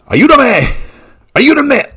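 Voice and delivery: desperate high shout for help; yelling for help. Retold or imitated. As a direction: scream it out over the waves.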